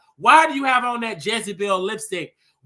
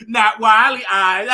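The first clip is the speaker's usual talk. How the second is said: loud drawn-out punchline into laughter; slow, loud delivery breaking into a cackle; laughing at own joke; 6 dB louder, slower than usual. Real, not acted. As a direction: crack yourself up on the punchline.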